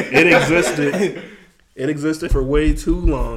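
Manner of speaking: slow, loud words through laughter; laughing emphasis. Real, not acted. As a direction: drag it out while laughing.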